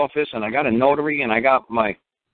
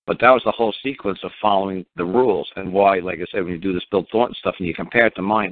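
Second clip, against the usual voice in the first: ordinary talk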